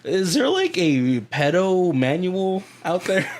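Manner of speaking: high, laughing question; amused and incredulous; laughing disbelief. Real, not acted.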